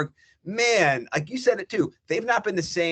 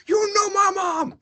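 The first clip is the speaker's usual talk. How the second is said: much higher, louder, slow, offended yell; hammed-up furious shout of the victim; mock outraged shout; 5 dB louder, slower than usual. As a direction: play the guy losing his mind. Imitating an enraged victim.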